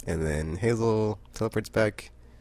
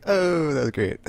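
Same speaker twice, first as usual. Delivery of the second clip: high, loud, coming out of a laugh; delighted laughter; 9 semitones higher, 6 dB louder, slower than usual. Real, not acted. Fan loving the plot twist, laughing. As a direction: still giddy, savour the moment. Laughing, recalling own reaction.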